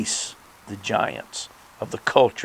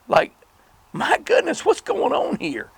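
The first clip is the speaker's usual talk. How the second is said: mock bewildered. Preacher voicing David's bafflement.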